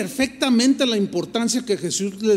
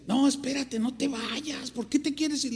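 higher, softer urging voice; retold pleading; 6 semitones higher, 7 dB quieter than usual. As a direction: coax someone not to leave. Pastor urging him to stay.

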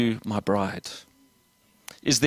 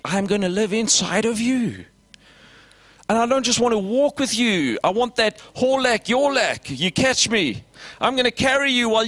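much higher, urgent sermon voice; impassioned preaching; 8 semitones higher than usual. Acted. Preacher voicing Jesus to his disciples, impassioned. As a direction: press the promise home with fire.

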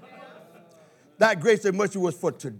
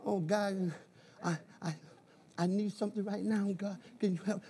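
soft, much quieter, hesitant plea; timid wimpy prayer; 14 dB quieter than usual. Pretend. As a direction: pray like a scared wimp, barely audible. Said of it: "not like".

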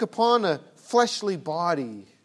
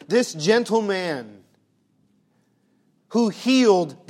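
louder and louder preacher's build; rising preaching; 4 semitones higher, 8 dB louder than usual. Acted.

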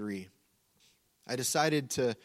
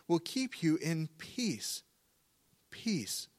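slow and higher, with a long pause before the second "peace,"; emphatic repetition; 4 semitones higher, slower than usual. Shown as a demonstration. Preacher applies the verse to listeners, emphasizing the doubled "peace,". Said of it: "use repetition to emphasize certain words".

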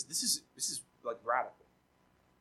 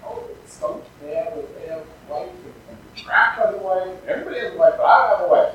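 put-on whiny baby Adam; 3 semitones higher, 5 dB louder than usual. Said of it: "crying like a little baby".

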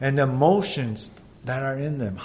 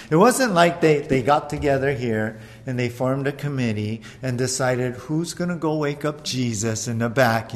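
ordinary talk, nothing marked

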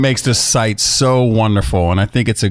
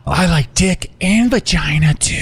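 mock sexy voice; 6 semitones higher than usual. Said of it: "you wonder why people get pissed".

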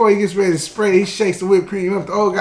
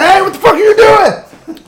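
much higher, louder bystander yell; mock outraged shout; 13 semitones higher, 9 dB louder than usual. People confronting the prankster, mock outrage. People react to baby hitting.

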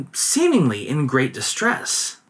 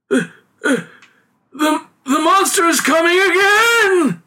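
stammering, high, terrified cry; aghast cry; 4 dB louder than usual. A narrator's reading. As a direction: shriek it out in horror. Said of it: "he cried, aghast".

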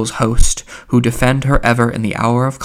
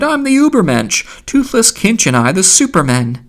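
crying out; 8 semitones higher, 3 dB louder than usual. A narrator's reading. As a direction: cry it out high, then come down on the last word. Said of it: "he cried".

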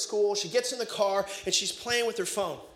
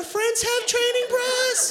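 much higher, strained nervous question; panicked squeak; 14 semitones higher, 3 dB louder, slower than usual. Retold or imitated. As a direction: blurt it way too high. Awkward dad asks daughter.

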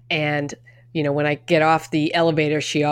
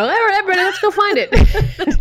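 hammed-up call to everyone, pitched way up; mock rally call; 5 dB louder than usual.

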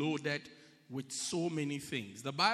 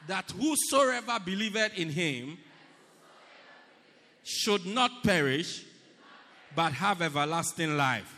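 louder recitation; 2 semitones higher, 8 dB louder than usual. Shown as a demonstration. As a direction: call it out with the whole church. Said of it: "Let's try it again".

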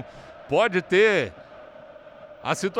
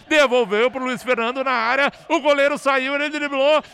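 rising to a shouted goal; building goal call; 7 semitones higher, 6 dB louder than usual. Real, not acted. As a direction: build fast and explode into the goal. Goal scored, narrator builds. (narração crescendo até o grito de gol)

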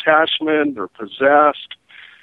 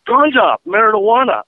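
higher mock Jamaican insisting; Jamaican accent; 7 semitones higher, 4 dB louder than usual. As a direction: insist like the beach hustler. Jamaican guy explaining.

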